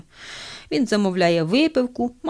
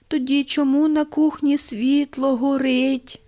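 higher, slow put-on old man's voice; weak dying old man; 6 semitones higher, slower than usual. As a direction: draw out the deathbed question. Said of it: "слабким голосом запитує".

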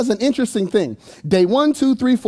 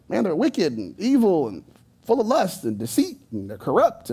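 softer, mock-disapproving grumble; badmouthing people; 6 dB quieter than usual. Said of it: "he's talking bad about people".

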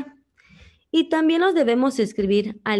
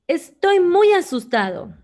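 much higher and louder, punching "muy"; exclaimed; 8 semitones higher, 6 dB louder than usual. Shown as a demonstration. Teacher giving the answer, frightened exclamation. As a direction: cry out "Estoy muy asustado" in alarm. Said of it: "Esta es una oración de exclamación".

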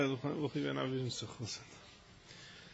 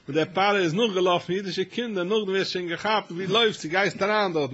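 louder, higher, driving the point home; full-force preaching; 5 semitones higher, 7 dB louder than usual. Acted. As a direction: build it up like a preacher at the climax.

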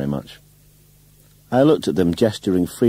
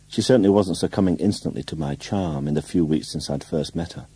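plain reading voice

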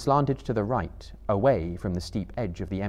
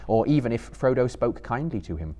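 usual speaking voice

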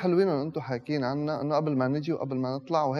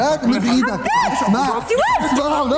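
shouting; 12 semitones higher, 8 dB louder, slower than usual. Acted. Staged fight, shouting.

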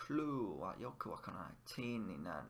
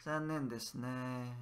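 slow. Shown as a demonstration. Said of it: "It's like saying, "Isn't it?".

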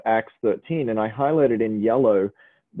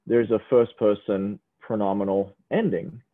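usual speaking voice

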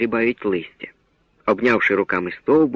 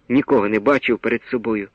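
ordinary delivery, nothing marked

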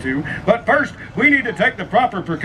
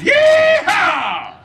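very high, loud whooping yeehaw; Texas yeehaw; 5 dB louder than usual. Shown as a demonstration. Texan shows the Indiana crowd a proper yeehaw. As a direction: holler it like a cowboy. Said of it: "the only proper response is".

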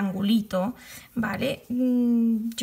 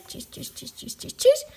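quick "chis" noises, hushed then jumping up high; imitated snipping noise; 10 semitones higher than usual. Shown as a demonstration. Vlogger adding her own sound effect as she works, imitated noise.

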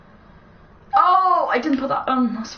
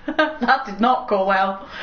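slow, lower words out of a laugh; laugh, then admit defeat slowly; rueful laughter; 6 semitones lower, slower than usual. Real, not acted. Gamer after a failed attempt, laughing ruefully.

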